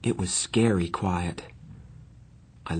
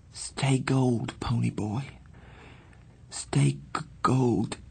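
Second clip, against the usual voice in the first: weak, higher, halting whispery voice; faint, dying; 4 semitones higher, slower than usual. A narrator's reading. Dying boy's last words to his friend, faint. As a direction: struggle the words out with failing breath. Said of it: "I barely heard him".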